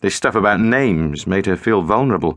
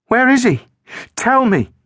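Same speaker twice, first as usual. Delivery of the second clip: demanding. A narrator's reading. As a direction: push hard for the truth. Girl demanding answers.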